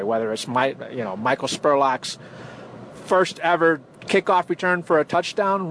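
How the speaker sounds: ordinary delivery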